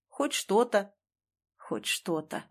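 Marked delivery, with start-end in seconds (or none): 1.6-2.4 low, very soft echoed reply; hollow echo. A narrator's reading. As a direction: repeat it like a fading echo, low and quiet. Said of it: "эхом откликнулся Бессонов". (тихим, низким эхом)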